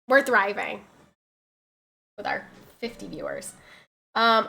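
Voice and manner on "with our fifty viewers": unvoiced self-mocking whisper; hushed wry aside. Real, not acted. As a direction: whisper the joke under your breath. Whispered joke about viewers.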